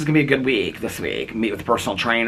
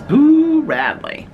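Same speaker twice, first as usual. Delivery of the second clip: very high, loud, long-held Boo; exaggerated neighbor's call, swooping down at the end; imitating the neighbor; 7 dB louder, much slower than usual. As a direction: hoot the name out slowly like the neighbor. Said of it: "she always says".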